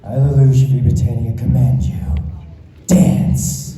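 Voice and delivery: loud, commanding anime-prince proclamation; Lelouch command. Pretend. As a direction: declare it like a royal issuing an absolute order. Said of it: "can you say my favorite quote from Code Geass".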